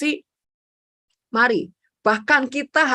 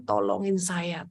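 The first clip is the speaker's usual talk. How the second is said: soft, slow plea for help; slow pleading prayer; 3 semitones lower, 8 dB quieter, slower than usual. Retold or imitated. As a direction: draw out the plea gently. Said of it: "Saya akan bereaksi gini".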